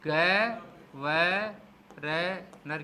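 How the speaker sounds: slow split syllables, ग व र नर; sounding out syllables. Shown as a demonstration. Teacher sounding out governor for the class, syllable by syllable. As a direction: break governor into separate sounds. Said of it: "मैं करके बता रहा हूँ कैसे करना है".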